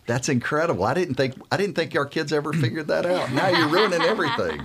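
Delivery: high, loud, laughing mock outrage; playfully hammed-up dismay, cracking up. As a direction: protest through laughter, pitch way up. Mock dismay at teen's admission.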